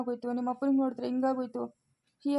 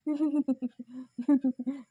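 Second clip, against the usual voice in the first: strange, unhinged chuckle; odd detached laugh. Acted. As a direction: laugh like the mind has slipped. Father suddenly acting strange. (ವಿಚಿತ್ರವಾದ ನಗು)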